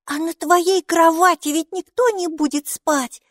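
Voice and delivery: put-on high, childlike girl pointing it out; a small girl chiming in, high and innocent; little girl voice. A narrator's reading. Little Mikey points out to mom, high childlike voice.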